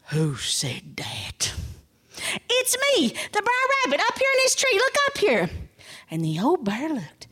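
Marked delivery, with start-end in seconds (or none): 0.1-1.5 deep, breathy, very slow bear; slow-witted low bear voice; puzzled bear. Acted. Startled bear wonders aloud. 2.5-5.5 very high, eager rabbit voice; high-pitched storybook rabbit; rabbit calling down. Acted. Rabbit calls down from tree.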